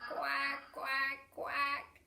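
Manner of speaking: playful mock quacking; light cheerful quacks; duck quack. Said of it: "what bird makes that sound".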